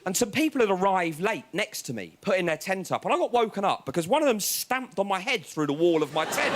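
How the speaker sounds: ordinary talk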